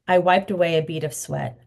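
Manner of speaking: ordinary delivery, nothing marked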